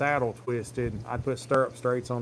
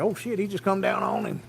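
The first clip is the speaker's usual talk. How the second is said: a pitched-up startled oh shit; startled cry; 8 semitones higher than usual. Retold or imitated. Friend reacts as the bull lands on him, surprised. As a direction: blurt it out in mild mock alarm. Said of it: "He's like".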